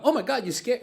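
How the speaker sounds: startled. Pretend. Passerby startled on the street, mock startled. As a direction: act startled by someone.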